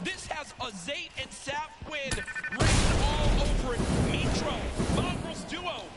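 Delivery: wrestling commentator. Acted. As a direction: call it like a title match. Live play-by-play building hype.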